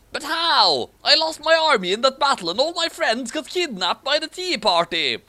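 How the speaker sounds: high, urgent outburst; excited. Acted. Hero asking his rescuers how, high alarmed outburst. Hero despairs after losing army.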